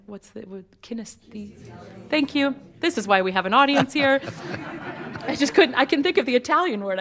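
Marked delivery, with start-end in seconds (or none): 4.7-7.0 fast, higher and louder, laughing; embarrassed laugh. Real, not acted. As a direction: laugh it off quickly, a bit flustered. Laughing at forgetting a word.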